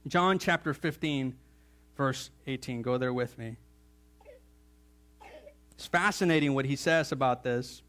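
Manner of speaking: ordinary talk, nothing marked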